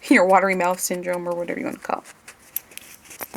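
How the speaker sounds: ordinary talk, nothing marked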